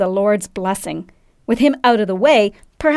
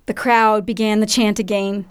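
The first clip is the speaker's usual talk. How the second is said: ordinary delivery, nothing marked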